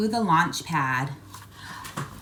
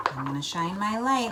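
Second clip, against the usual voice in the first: ordinary delivery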